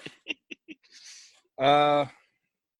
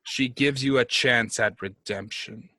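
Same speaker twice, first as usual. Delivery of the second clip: measured, solemn decree with pauses; imperious dark lord. Acted. Posing as a tyrant god offering a servant redemption, solemn. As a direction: hand down a task as a god would.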